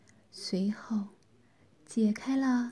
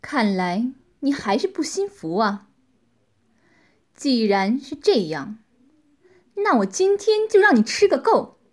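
cold anger; 8 dB louder than usual. A narrator's reading. Woman threatening the captive who still defies her, cold anger building. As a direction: start coldly, then rise to a forceful threat. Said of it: "闪过一丝怒气，冷冷地说道".

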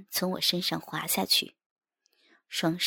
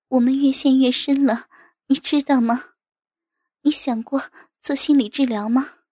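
higher, louder, pleading wife's voice; worried wife; 7 semitones higher, 8 dB louder than usual. A narrator's reading. Wife pleading with her husband over their deepening trouble, worried.

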